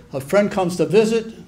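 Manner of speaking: ordinary delivery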